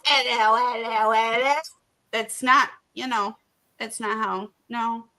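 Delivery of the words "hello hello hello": mock cockney greeting, lightly done. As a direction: toss out a silly British "'ello" three times.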